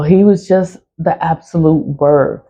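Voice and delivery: usual speaking voice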